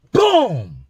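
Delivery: a sudden punchy boom; loud mock impact. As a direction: slam the word out like the punch. Retelling a punch landing.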